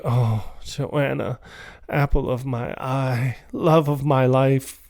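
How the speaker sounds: soft, slow, mock-romantic crooning; mock tender. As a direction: woo her, hammed-up and syrupy. Retold husband wooing wife on phone.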